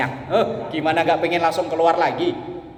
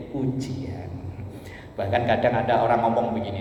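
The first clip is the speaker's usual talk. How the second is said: ordinary delivery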